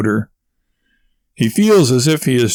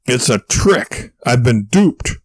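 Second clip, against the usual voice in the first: mild yell; slower than usual. A narrator's reading. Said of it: "Warble yells out".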